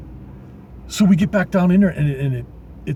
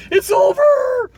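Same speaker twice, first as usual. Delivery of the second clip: high, slow cry of panic as the trucker thinks he is busted; panicked; 4 dB louder, much slower than usual. Retold or imitated. Trucker to himself at the inspection, panicked high slow wail. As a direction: wail it like you're already caught, drawing the words out. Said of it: "I'm like".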